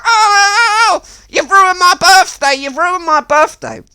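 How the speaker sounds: hammed-up blubbering, very high and loud; mock crying Miles. Miles wailing at Jeff over his ruined birthday, hammed-up mock crying. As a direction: wail like a spoilt child. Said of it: "And he was like".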